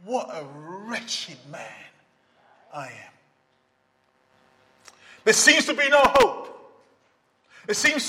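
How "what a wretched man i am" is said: much softer and lower, slow lament; cry out. Retold or imitated. Preacher voicing a believer's cry, soft and grieving. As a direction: sink the words into a hushed, grieving sigh. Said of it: "to cry out and say".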